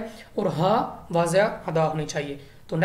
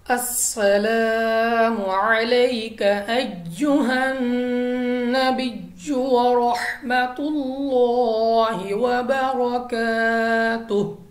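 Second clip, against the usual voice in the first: slow, melodic, drawn-out chanting of Arabic; Quranic recitation; 3 semitones higher, much slower than usual. Shown as a demonstration. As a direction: recite with long held vowels and tajweed. Teacher recites Quran demo.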